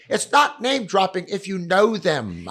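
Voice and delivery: it's not name dropping shouted high and loud, slowly; yelled. Retold or imitated. Paris answering people on a tech scout, with a slow loud yell. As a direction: yell it back at the room, slowly and firmly. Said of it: "He had to yell that".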